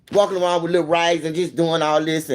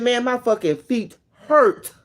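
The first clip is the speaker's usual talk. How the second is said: drawn-out complaint; 7 semitones higher, much slower than usual. Retold or imitated.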